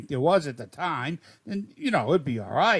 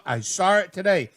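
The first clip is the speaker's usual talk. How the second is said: ordinary delivery, nothing marked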